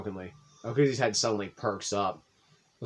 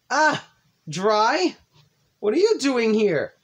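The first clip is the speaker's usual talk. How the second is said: surprised; 12 semitones higher, 7 dB louder, much slower than usual. Acted. Sayori surprised to see him.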